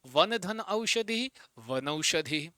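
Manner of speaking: teacher repeating the split form slowly for students; slow repeated dictation. Shown as a demonstration. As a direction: lift and slow वन धन Aushadhi. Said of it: "repeats the split for class".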